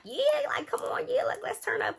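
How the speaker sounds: very high, squealy party cheer; hyped-up. Retold or imitated. Selena cheers on doing shots after this, hyped-up. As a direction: imitate an excited friend pushing for shots, voice way up high.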